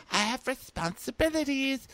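very high mock-precious voice; hammed-up high-pitched parody; imitated mom. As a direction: squeak it as an overwrought mom.

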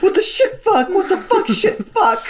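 high, laughing mock panic screams; panicked screaming. Burning Lupin screams curses at the fire, high hammed-up panic. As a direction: shriek the curses high, half cracking up. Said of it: "starts screaming".

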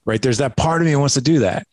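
ordinary talk, nothing marked

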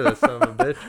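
spotting the guy who has their money; retold yell. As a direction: shout it across the crowd. Spotting Bob at the gate.

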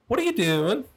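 put-on high, airy drunk college girl. Cop retelling a drunk college girl's question, high girly voice. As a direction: play the tipsy girl asking.

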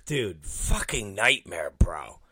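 hushed, breathy whisper with no voice; a low confiding whisper to a friend. Retold or imitated. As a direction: whisper it like a secret you don't want overheard. Confiding about Mike to Kleinrock.

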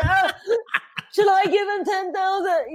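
high, tearful comic whine; mock crying plea. Host offering her own money to loser, mock crying. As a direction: wail it as if in tears.

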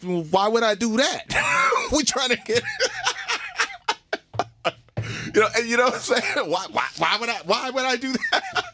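high, laughing disbelief. Real, not acted. Telling the host why he didn't pass it, laughing high. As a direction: laugh through the question.

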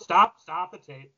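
ordinary talk

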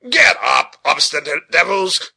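loud shout at stubborn horses; blustering driver's shout; angry cry. A narrator's reading. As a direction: yell impatiently to drive the team on. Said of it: "cried Richard".

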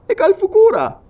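very high, loud query; astonished question. Acted. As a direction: ask in high-pitched disbelief. Listener asks in growing surprise.

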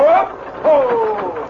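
high, loud call to the horses; driver calling out. Acted. Stage driver hollering the horses to a halt. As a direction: haul the team to a stop with a shout.

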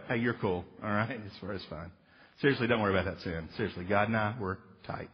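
lightly voiced careless buddy; mock casual dude. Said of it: "That doesn't mean I just go".

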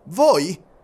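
can't believe it; surprised exclamation. Acted. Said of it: "esclamò Fix". (Fix sorpreso, esclamazione acuta)

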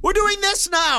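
exasperated dad at wit's end; dad to bored son, in a high exasperated squeal; mock exasperated dad. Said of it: "I'm like".

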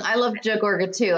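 ordinary talk

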